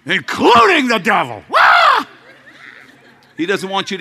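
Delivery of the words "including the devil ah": high, loud shouted preaching; fiery preaching. Acted. Preacher fires up the congregation, high loud shouting. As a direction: preach it to the rafters.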